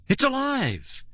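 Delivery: high terrified scream. A narrator's reading. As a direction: shriek in terror. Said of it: "wild scream".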